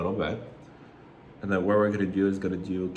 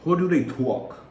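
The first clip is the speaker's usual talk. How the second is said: higher, slow, broad Long Island accent; 8 semitones higher, 4 dB louder than usual. Shown as a demonstration. Trying out how Long Islanders talk, Long Island accent. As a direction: stretch it into an exaggerated Long Island drawl. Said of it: "work on my Jersey accent".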